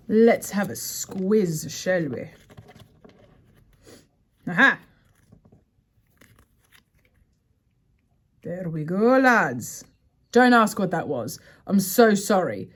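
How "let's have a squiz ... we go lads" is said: lightly put-on Scottish accent, playful; a half-hearted stab at a Scottish accent; a hint of Scottish brogue for the lads; mock Scottish accent. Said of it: "Scottish, uh, accent that I just tried to impersonate".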